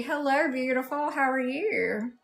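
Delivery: usual speaking voice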